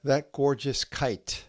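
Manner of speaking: slow haiku reading with long pauses; poem reading. Acted. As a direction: let each image hang in the silence.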